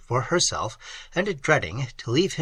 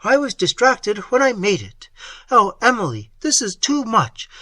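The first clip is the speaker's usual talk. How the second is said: high, loud, agitated voice; frantic lover; 6 semitones higher, 4 dB louder than usual. A narrator's reading. A distraught lover protesting to her, loud and high. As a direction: rage against the Count.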